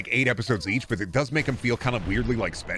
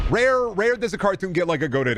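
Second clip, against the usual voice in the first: mock proclamation; 8 semitones higher than usual. Mock-grand emphatic declaration.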